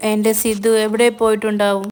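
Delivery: usual speaking voice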